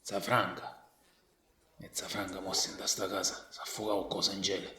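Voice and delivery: soft low Sicilian dialect; quiet Sicilian dialect. Real, not acted. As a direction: murmur it in Sicilian. Hushed Sicilian asides.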